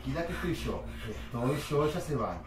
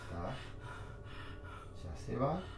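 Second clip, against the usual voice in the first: soft, low reassurance fading away; soothing her softly once it passes; 4 semitones lower, 9 dB quieter, slower than usual. Real, not acted. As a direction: settle her down quietly. Soothing after the outburst.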